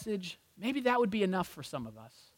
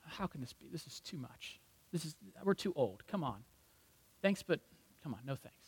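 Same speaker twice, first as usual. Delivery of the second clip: far softer, mumbled disbelief; muttered doubt; 4 semitones lower, 12 dB quieter than usual. Retold or imitated. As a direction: grumble it under your breath, brushing the gift off. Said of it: "picture him going".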